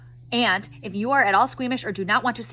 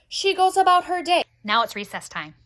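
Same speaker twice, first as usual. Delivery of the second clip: normal talking voice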